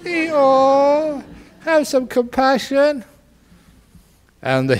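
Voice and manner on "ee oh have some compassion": high braying plea; donkey begging the farmer for compassion; pleading donkey. Acted. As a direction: bray out a plea for mercy. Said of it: "And poor donkey".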